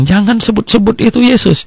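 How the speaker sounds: pitched high in mock indignation; imitating a man scolding the preacher; retold angry outburst. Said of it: "Dia ribut sama saya".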